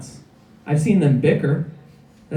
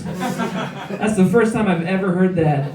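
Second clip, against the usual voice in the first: usual speaking voice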